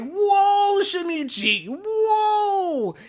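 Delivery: high, drawn-out mock whoas; shocked crowd. Everyone reacts to Shinichi's remark about bodies, mock shock. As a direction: exclaim loudly, stretching each whoa way up high.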